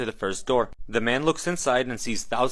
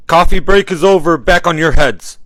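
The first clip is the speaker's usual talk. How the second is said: booming, much higher announcement; loud speaker voice; 6 semitones higher, 14 dB louder than usual. Acted. Loudspeaker orders the damned back to it, loud and high. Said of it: "a loud voice on the speaker says".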